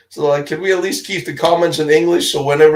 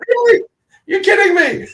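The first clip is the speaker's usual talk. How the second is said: high, amazed mock disbelief; mock surprise. Telling how hotel staff recognized him, mock-amazed and high. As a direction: squeal it like you can't believe it. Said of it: "And I'm like".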